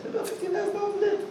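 a quick high shocked aside; high fast gossip voice. Retold or imitated.

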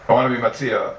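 ordinary talk, nothing marked

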